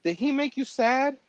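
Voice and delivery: put-on high, worried little kid; a small child's innocent question. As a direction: daughter asks mother about him, childlike. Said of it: "then it's like".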